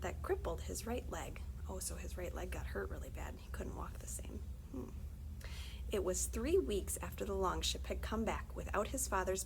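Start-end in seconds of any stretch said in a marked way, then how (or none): none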